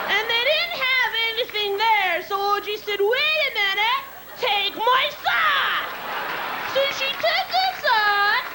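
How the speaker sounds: high, flustered excuse-making; a hammed-up scrambling attorney; mock defense lawyer. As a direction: stammer through a desperate alibi.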